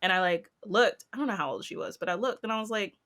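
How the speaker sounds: normal talking voice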